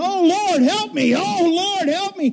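high frantic cries for help; panicked screaming. Retold or imitated. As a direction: scream to the Lord as you slide off. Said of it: "he started screaming".

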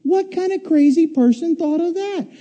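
high, loud mock panic; a hammed-up frightened rider at the top; panicked disbelief. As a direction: squeal it like a scared rider. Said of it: "It's like".

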